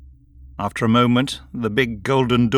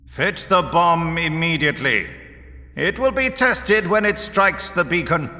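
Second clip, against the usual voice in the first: higher, urgent, imperious character voice; commanding leader Vorus; 7 semitones higher than usual. A narrator's reading. Vorus gives his engineer four hours, commanding leader. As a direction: lay down the deadline with authority.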